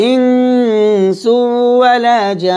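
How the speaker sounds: tajweed recitation example; recitation demo. Shown as a demonstration. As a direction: draw out the first word, recite. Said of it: "যেমন এখানে দেখুন, আমরা যদি পড়ি".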